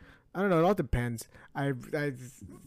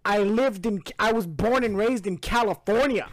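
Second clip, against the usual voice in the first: Tom Brady impression; 8 semitones higher, 8 dB louder than usual. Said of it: "And then he's like".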